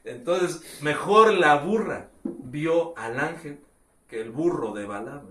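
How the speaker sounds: ordinary talk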